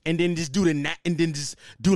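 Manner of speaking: usual speaking voice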